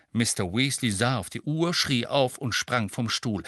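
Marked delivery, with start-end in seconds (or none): none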